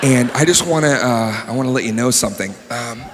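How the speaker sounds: low, casual intruder; soft, hesitant low voice breaking news; the world as a person. Acted. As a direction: introduce yourself low and offhand. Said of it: "I'm the fucking world".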